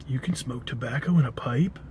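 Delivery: a dazed dude puzzled; stoner drawl. Retold or imitated. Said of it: "that accent was".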